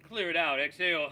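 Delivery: forceful call to exhale; loud breath cue. Shown as a demonstration. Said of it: "breath cue, louder".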